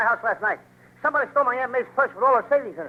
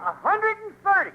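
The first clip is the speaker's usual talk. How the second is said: "A hundred and thirty" pitched way up; high, emphatic "hundred and thirty"; emphatic repeat; 10 semitones higher than usual. Acted. Insisting on an absurd age.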